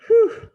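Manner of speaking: high, loud whew; big exaggerated whew; whew of relief. Freak's relief after escape.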